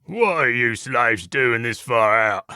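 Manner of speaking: slow, rasping Cockney wolf; sandpaper-voiced Cockney challenge, drawn out. A narrator's reading. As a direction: rasp it out like a rough Cockney brute. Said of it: "shouted at us with a sandpaper voice and what could only be a Cockney accent".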